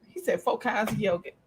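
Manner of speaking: normal talking voice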